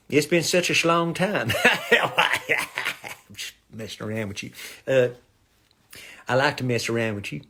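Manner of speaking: ordinary talk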